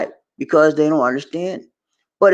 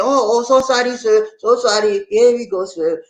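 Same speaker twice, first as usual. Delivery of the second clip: imitated apologetic clerk; 7 semitones higher than usual. Imitates clerk finally getting it, apologising.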